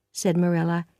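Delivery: ordinary delivery, nothing marked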